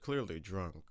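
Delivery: usual speaking voice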